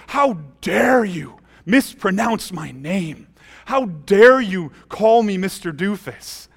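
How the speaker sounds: slow, loud soapbox indignation; hammed-up outrage, each word drawn out; mock railing. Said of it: "if I were to rail".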